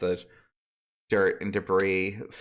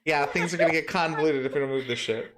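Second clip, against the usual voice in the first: quick laughing repeat; amused, laughing fast; 6 semitones higher, faster than usual. Real, not acted. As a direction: rattle it off while laughing. Repeating GM's line, laughing.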